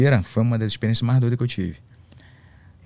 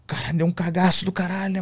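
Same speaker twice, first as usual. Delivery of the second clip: ordinary delivery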